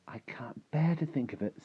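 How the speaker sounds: high, hushed little protest; lightly voiced upset girl; distressed cry. A narrator's reading. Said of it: "Sophie cried".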